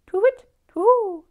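high, loud, drawn-out owl call; high storybook owl hooting. A narrator's reading. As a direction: hoot slowly like an owl.

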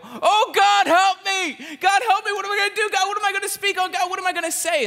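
high, loud pleading wail to God; desperate cry to God. Retold or imitated. Alone in his prayer closet, begging God, high loud pleading cry. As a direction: cry out in mock panic, begging for help.

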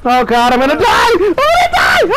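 panicked scream. Real, not acted. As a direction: shriek like you're about to die. Panicking about dying in game.

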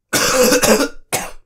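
loud choking cough; harsh coughing fit. Real, not acted. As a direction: cough hard from the burn.

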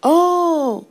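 high, sing-song call to Mister Happy; sing-song townsfolk voice. Retold or imitated. Storyteller voicing a neighbor calling Mister Happy, high sing-song. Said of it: "they'd say".

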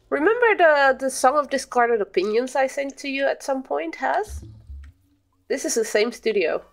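ordinary delivery

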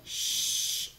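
long, forceful hush; drawn-out "shh". A narrator's reading. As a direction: shush a whole room. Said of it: "everyone said".

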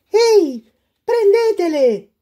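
high, loud cry after them; girl yelling for help catching her ears; shouting. A narrator's reading. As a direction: yell to stop the runaway ears. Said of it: "gridò Cache Mache".

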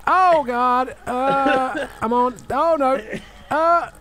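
loud, high, breathless panic; frantic panic. Real, not acted. Rushing to reach a friend in the game, loud and high. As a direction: scramble and yell that you're coming.

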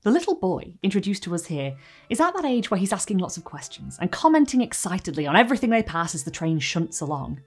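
measured storyteller reading a creepy tale; story narration. A narrator's reading. Reads the story aloud.

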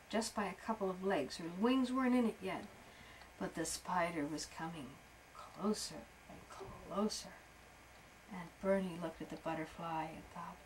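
ordinary delivery, nothing marked

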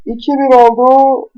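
high, loud commentator shout; excited goal call. Acted. Goal call in football game.

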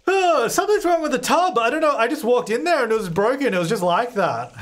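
high, hammed-up kid voice playing innocent; mock innocent kid. Voicing the kid denying he broke the tub. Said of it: "You're like".

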